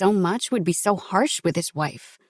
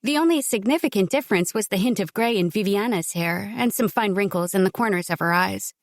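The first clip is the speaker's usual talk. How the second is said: plain reading voice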